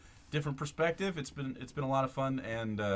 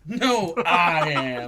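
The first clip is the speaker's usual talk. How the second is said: loud; 4 semitones higher, 12 dB louder, much slower than usual. Pretend. Mock competitive claim.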